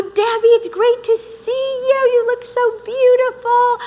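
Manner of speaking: put-on high, gushing girly greeting; gushing friend. Switching to greet a friend Debbie, high gushing. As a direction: greet her friend like she's adored. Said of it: "So you'd be like, Oh".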